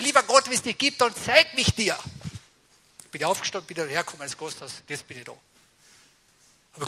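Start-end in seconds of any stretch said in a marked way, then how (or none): 0.0-2.0 high, loud desperate plea retold; retold cry to God. Man retelling his plea from his bed, crying out loud. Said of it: "hab ich geschrien".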